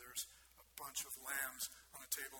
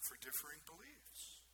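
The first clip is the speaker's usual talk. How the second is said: quieter repeat; 10 dB quieter than usual. Shown as a demonstration. Preacher repeats the answer to the congregation, hushed. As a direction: drop the volume and let "beliefs" rise. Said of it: "repeated answer in sermon".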